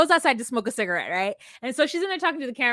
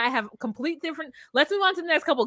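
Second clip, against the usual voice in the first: ordinary delivery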